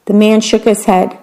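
usual speaking voice

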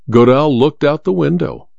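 ordinary delivery, nothing marked

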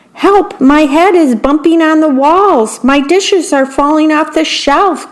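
high frantic calls for help; a worm tumbling in a rolling apple; panicked cry. A narrator's reading. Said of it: "cried the worm".

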